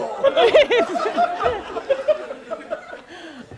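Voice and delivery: long, high real laugh. Real, not acted. Laughing while explaining the contestant to her friend, real laughter. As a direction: laugh it out, genuinely amused.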